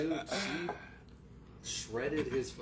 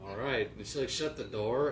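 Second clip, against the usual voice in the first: ordinary delivery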